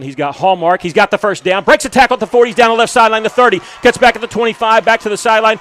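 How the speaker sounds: real excitement building to a loud call; excited play call. Real, not acted. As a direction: shout the big run as it unfolds. Big run called live.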